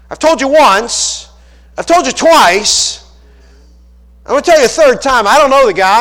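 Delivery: fed-up shouting at accusers; angry Peter. Acted. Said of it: "He's getting mad now".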